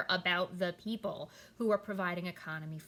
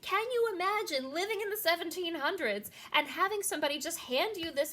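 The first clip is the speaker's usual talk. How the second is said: much higher and louder, incredulous at the absurdity; amazed outrage; 11 semitones higher, 5 dB louder than usual. Real, not acted. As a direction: rile them up, how ridiculous is this. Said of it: "This is ridiculous".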